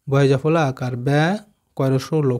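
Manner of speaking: normal talking voice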